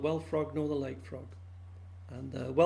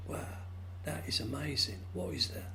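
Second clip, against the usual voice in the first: soft, breathless wonder, ending in a whisper; awed hush; 9 dB quieter than usual. Acted. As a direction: hush it like seeing a miracle. Frog awed by rainbow.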